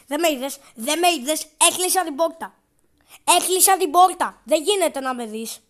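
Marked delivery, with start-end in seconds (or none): 0.1-2.5 loud, pleading insistence; louder protest that he wasn't seen; loud insisting. Real, not acted. Κρύβεται από τη γιαγιά. 3.2-4.4 very loud, slow shout of the door claim; shouted insistence. Real, not acted. Επανάληψη πιο δυνατά.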